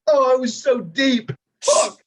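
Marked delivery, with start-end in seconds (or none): 1.6-2.0 very high anguished yelp; shrieked curse. Acted. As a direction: cry out in despair. Despair at losing group.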